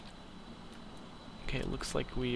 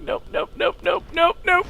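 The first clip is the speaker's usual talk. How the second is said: panicked nope; 7 dB louder than usual. Real, not acted. Crash imminent.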